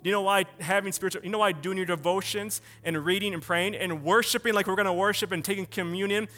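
urgent, rolling sermon build; impassioned preaching. Acted. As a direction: preach it in driving questions, building to a loud finish.

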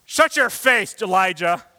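high, loud mock snap at Elijah; angry rebuke. Imagined God scolding Elijah, loud mock anger. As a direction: bark it like a fed-up boss. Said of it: "Instead of saying".

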